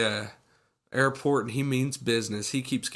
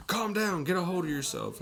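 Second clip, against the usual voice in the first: mock urgent scolding; 7 semitones higher than usual. Imitating the doctor confronting the panicking woman, mock urgent. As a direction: play an insistent doctor taking charge.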